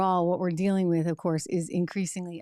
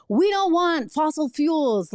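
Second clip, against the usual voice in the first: higher, slow, hammed-up mock activist; sarcastic mimic of opponents' slogan, higher and slow; 8 semitones higher, 4 dB louder, slower than usual. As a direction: parrot their line back in a silly voice.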